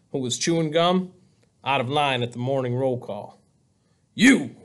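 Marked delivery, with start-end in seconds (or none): 4.1-4.5 short roar, higher and louder; a sudden angry roar. A narrator's reading. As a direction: roar it like an accusation. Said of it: "he roared".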